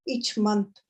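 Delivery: normal talking voice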